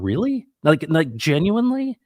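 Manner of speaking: rising high, disbelieving really; can't believe what he's hearing; incredulous surprise. Retold or imitated. Asking his dying buddy in disbelief, high incredulous surprise. Reacting to friend's cancer remark.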